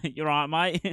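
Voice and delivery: badly faked Aussie accent, amused and loud; Australian accent. Shown as a demonstration. Greeting people as a child would, hammed-up Australian accent. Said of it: "I could do an Australian accent perfect".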